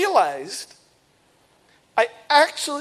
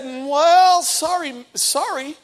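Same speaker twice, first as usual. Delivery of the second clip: mock apologetic agent; 6 semitones higher, much slower than usual. Gate agent apologizing to the traveler, mock sheepish. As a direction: play the agent shrugging it off.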